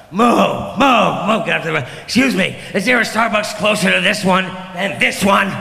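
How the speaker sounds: lightly hammed-up impatient customer; pushy coffee customer. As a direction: push through the crowd asking, voice up. Acting out a frantic coffee customer.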